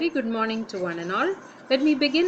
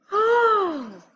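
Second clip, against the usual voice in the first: surprised "oh", much higher and louder, long falling glide; high-pitched surprised exclamation; 10 semitones higher, 5 dB louder than usual. Shown as a demonstration. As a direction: let out a big, high, drawn-out "oh" of surprise. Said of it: "Imagine that you are surprised".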